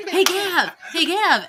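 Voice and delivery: high, sing-song wake-up call; calling to wake someone. Retold or imitated. Kid kicks door, calls brother.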